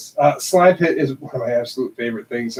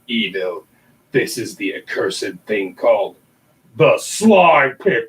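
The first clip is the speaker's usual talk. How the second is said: ominous story narration; 4 semitones lower than usual. Acted. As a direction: tell it like a spooky legend. Reads ominous story intro.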